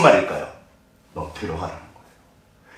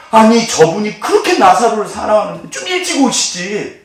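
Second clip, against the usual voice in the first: higher, put-on grumbling voice; mock complaint; 6 semitones higher than usual. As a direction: whine that he should have come sooner. Voicing the crowd's grumble. (투덜대는 구경꾼 흉내)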